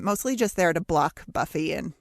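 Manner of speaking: normal talking voice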